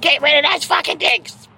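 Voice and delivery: high, sharp mock yell at her husband; exasperated wife shouting about old shoes. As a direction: imitate the wife snapping to throw them out. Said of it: "she's like".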